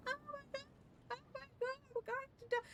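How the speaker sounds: tiny high-pitched hushed panic squeal; soft, very high imitation of a freak-out; mock panic. Passenger imagining the plane's gonna crash, mock panic. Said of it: "she was like the freak out scene".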